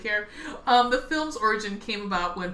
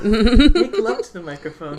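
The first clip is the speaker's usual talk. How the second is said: mock sultry; 11 dB louder, slower than usual. Joking about ASMR intimacy.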